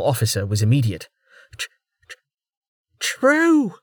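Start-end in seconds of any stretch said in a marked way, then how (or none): 1.6-3.8 stammered true, high and shaken; stuttering out true in shock; stammering. A narrator's reading. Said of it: "she stammered".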